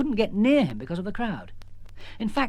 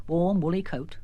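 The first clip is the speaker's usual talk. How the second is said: usual speaking voice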